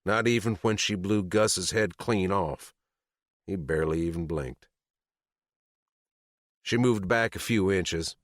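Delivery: ordinary delivery, nothing marked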